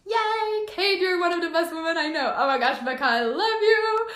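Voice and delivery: real high-pitched, singsong squeal of delight; thrilled, stretched-out happy squeals; overjoyed. Real, not acted. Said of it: "I am so happy".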